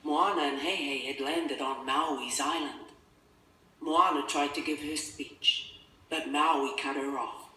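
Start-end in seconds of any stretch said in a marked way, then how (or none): none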